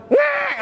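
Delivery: sharp high yelp of a shocked brain; startled jolt noise. Retold or imitated. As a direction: squeak like a jolt of electricity. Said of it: "Ini otak kayak kaget". (teriakan kaget melengking)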